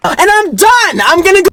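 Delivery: high-pitched mock meltdown; going crazy. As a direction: squeal it like you've completely had enough. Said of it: "he went that crazy in this situation".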